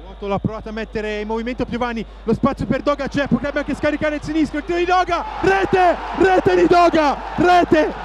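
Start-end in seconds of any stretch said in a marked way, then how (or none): 2.2-7.9 rising to a full goal scream; breathless italian goal commentary. Real, not acted. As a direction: yell the goal, breathless and high. Goal call, commentator erupts.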